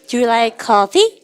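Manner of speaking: very slow, each word drawn out; slow choral reading. Shown as a demonstration. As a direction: read it slowly for the class to follow. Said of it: "Do you like, 시작". (천천히 또박또박 읽기)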